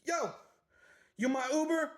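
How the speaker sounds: quick high "yo" to flag someone down; calling out. Retold or imitated. Woman at the window hailing her Uber, calling out.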